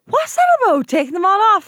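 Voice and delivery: high, loud incredulous question; shocked question. Real, not acted. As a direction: ask it in disbelief, voice jumping up. Shocked question about Barbies.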